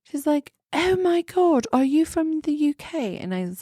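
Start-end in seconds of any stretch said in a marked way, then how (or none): 0.7-3.2 high mock-British astonishment; pitched-up British impression; imitated British accent. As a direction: gush in a fake British accent. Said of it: "probably doing, uh, that accent".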